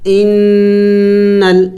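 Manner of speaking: ان نل with the noon held and hummed through the nose; nasal ghunna. Shown as a demonstration. Teacher shows students the ghunna, nasal hum on ان نل. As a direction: hum the نون of ان into نل. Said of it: "میں نے غنہ کیا، ناک میں آواز کو پیدا کیا".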